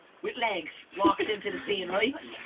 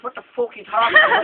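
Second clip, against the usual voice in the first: higher, fast, mock angry blurt; mock outburst; 9 semitones higher, 4 dB louder, faster than usual. Leanne yelling at the giraffe, mock angry and fast. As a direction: snap it out in fake outrage. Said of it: "Leanne goes".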